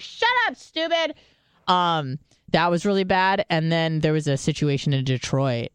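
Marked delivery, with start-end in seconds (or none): 0.0-1.1 high, sharp mock snap at a nosy sister; shutting down a worried sister, mock irritated snap; snapping at sister. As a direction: bite her head off, much higher. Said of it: "I'm like".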